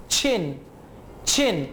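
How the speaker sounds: chin with a crisp ch at the start. Shown as a demonstration. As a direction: say chin with a clean ch, low and falling. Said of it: "सीएच is usually pronounced somewhat like". (चिन में साफ़ च की आवाज़)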